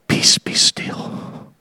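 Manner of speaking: slow, hushed whisper. Acted. Jesus stilling the storm from the ship's edge, whispered. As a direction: barely breathe it out, slowly. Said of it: "I believe he just whispered it".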